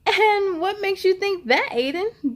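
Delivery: high, loud teasing question; mocking friend doubting him; smirking. A narrator's reading. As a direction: sneer at his dream. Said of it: "D J asked with a smirk".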